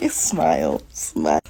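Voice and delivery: very low, soft mock-pleasant words; forced composure. Woman forces a smile at her rival, low and restrained. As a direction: drop low and soft, holding it in.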